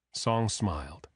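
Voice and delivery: plain reading voice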